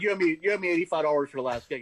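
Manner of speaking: loud, heated demand for the money; retold angry confrontation. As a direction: bark at him to pay up. Angrily demanding the owed money.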